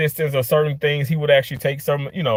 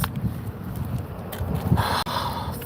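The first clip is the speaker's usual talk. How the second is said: heavy sigh; 8 dB quieter than usual. Real, not acted. Frustrated after the oil change.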